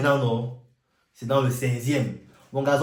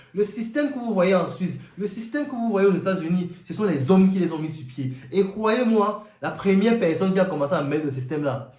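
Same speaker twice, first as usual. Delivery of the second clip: usual speaking voice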